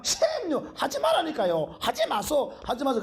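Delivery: Gyeongsang dialect plea. Retold or imitated. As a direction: whine at the teacher in Busan dialect. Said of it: "경상도 말로".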